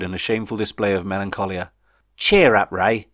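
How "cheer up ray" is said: encouraging call, loud at the start; lightly acted pep talk; urging. A narrator's reading. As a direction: urge a glum friend on. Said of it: "urged Jeff".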